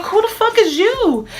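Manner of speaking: furious yell. Retold or imitated. Catching the ex with another man, angry high shout. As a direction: snap it back hard at him. Retold confrontation with rival.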